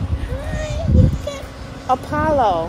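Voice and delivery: very high cooing greeting. Real, not acted. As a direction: squeal hello to a tiny puppy. Meeting puppy first time.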